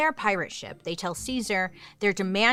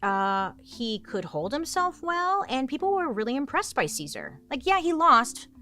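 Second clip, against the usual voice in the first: high, excited praise; pitched-up admiring gush; impressed; 7 semitones higher, 3 dB louder than usual. Acted.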